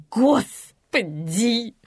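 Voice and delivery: very slow, exasperated drawl; long drawn-out groaning word. A narrator's reading. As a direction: stretch each syllable in disdain. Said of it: "протянула Инна".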